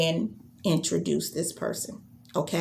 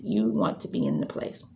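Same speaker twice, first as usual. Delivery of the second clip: said again much lower, settling down; dropped low; 12 semitones lower than usual. Shown as a demonstration. Coach echoing her own post in a low voice. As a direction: bring the pitch way down on the repeat. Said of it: "I kept talking about it on social media".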